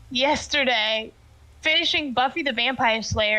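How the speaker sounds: ordinary talk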